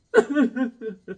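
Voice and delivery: short burst of laughter. Real, not acted. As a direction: laugh at the joke. Laughing at his own story.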